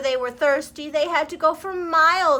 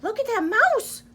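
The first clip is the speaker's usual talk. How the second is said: much higher chattering monkey voice; 11 semitones higher, 4 dB louder than usual. Acted. Monkey pointing out the brave mouse, higher chatter. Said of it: "chattered a monkey".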